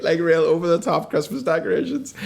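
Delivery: normal talking voice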